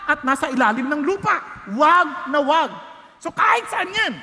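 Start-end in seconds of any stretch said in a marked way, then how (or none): 1.7-4.1 firm emphatic ban. Real, not acted. Preacher forbids idols firmly.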